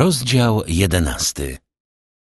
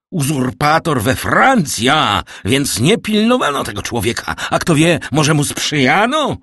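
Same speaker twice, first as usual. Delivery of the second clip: loud, high-pitched outrage; anger and alarm; 8 semitones higher, 5 dB louder than usual. A narrator's reading. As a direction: exclaim in furious alarm, pitch well up. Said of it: "z nieopisanym gniewem i trwogą".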